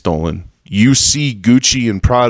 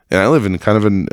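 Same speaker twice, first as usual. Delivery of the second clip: ordinary delivery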